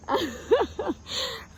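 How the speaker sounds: high airy laugh; a winded laugh on the rock; breathy laugh. Real, not acted. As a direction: let out a breathy little laugh. Laughing on a hiking break.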